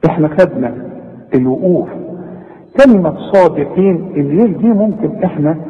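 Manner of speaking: ordinary talk, nothing marked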